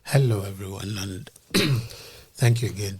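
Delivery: ordinary delivery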